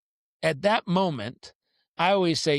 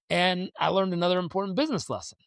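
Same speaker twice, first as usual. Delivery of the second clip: usual speaking voice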